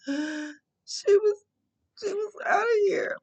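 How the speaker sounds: crying out high, the words breaking into a sob; sobbing outcry. Real, not acted. Mother reliving the moment her daughter nearly died, sobbing. Recalling daughter almost dying.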